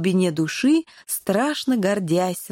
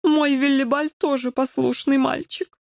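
higher-pitched, hesitant character voice; doubtful father, pitched up; unsure drawl; 5 semitones higher than usual. A narrator's reading. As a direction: draw it out uncertainly. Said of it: "неуверенно протянул Коржик".

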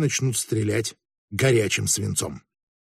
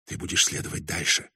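low, hushed and faint; barely audible; 9 semitones lower, 9 dB quieter than usual. A narrator's reading. A woman to the man she commands, barely audible. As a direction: say it softly, almost too faint to hear. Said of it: "еле слышно произнесла она".

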